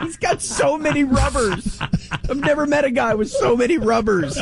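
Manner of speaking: amused disbelief. Real, not acted. Amused mock-incredulous comment.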